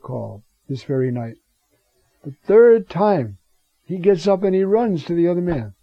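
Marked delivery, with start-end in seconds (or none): 2.2-3.4 The third time, slow with third punched loud; emphatic sermon stress on third. Acted. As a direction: lean hard on third, slowly. Preacher stressing third call.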